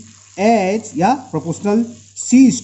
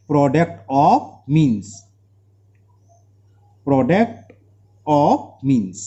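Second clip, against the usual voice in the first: slow dictation; 3 semitones lower, much slower than usual. Shown as a demonstration. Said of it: "या क्या लिख सकते हो?".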